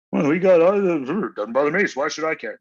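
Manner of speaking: high, loud mocking whine of a leftist. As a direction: sneer it out as the selfish other side. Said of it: "you guys all there on the left".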